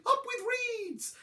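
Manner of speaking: high, drawn-out duckbill hoot; high dinosaur cheer. A narrator's reading. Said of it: "they hooted".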